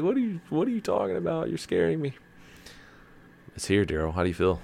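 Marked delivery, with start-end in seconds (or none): 0.0-2.1 very high, frightened whine imitating the wife; high mock fright; mock scared wife. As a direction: pitch it way up like she's spooked. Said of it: "She's like".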